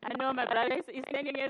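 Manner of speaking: ordinary delivery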